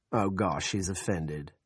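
ordinary delivery

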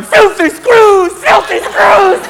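very high, slow bird squawk; caged bird. Acted. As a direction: squawk insults like a jailbird parrot. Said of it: "Little birds in their cages going".

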